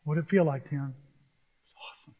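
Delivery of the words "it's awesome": barely audible, voiceless answer; awestruck whisper. Retold or imitated. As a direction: whisper it as if overcome. Overcome on touching the stadium.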